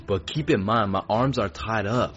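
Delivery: ordinary delivery, nothing marked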